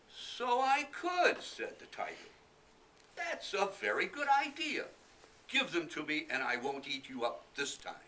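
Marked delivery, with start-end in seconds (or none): 0.1-1.4 high, loud, slow purring tiger. Acted. Tiger voice in read story. 3.1-7.9 high, loud bossy tiger voice; a pleased tiger making his deal; greedy tiger. Acted. Tiger voice in read story.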